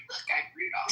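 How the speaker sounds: Rudolph. Retold or imitated. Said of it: "me with a red ball at the end of my nose going".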